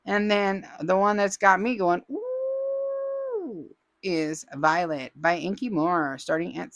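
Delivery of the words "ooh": delighted high ooh. Real, not acted. Host gushes over a favourite kit.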